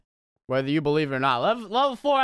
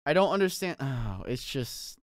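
ordinary talk

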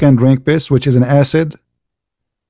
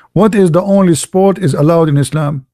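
ordinary talk

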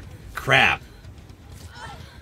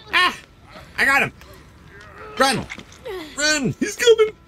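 high frantic yells, near crying; panicked screaming. Real, not acted. Gamer flees the killer, panicked high yells. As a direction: scream it while fleeing the killer.

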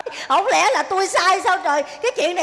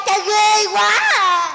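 drawn-out whine; much slower than usual. Acted. Daughter whines to father.

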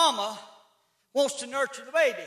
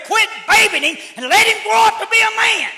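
loud, higher, forceful preaching shout; daddy's shout; 9 semitones higher, 12 dB louder than usual. Retold or imitated. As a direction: bellow it like a stern father laying down the law. Preacher quoting daddy loudly.

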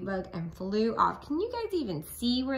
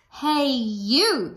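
grouchy shout; 5 semitones higher, 8 dB louder than usual. Acted. Ladybug challenges gorilla.

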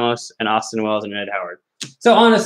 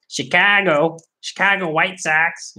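hammed-up Boston accent with stretched vowels; 3 semitones higher, slower than usual. Card breaker mangling Chicago's team name, mock Boston accent. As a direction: do a bad, drawn-out Boston accent. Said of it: "I did that in a Boston accent".

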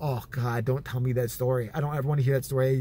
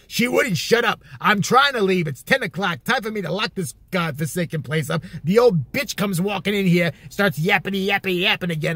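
loud, raised mock-exasperated tirade; irritated rant; 3 semitones higher, 4 dB louder than usual. Uncle defending himself about an old customer, exasperated rant. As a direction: vent as the fed-up uncle.

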